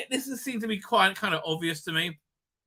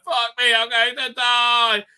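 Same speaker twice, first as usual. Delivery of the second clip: mock panic; 7 semitones higher, 6 dB louder, slower than usual. Crew member facing death voiced.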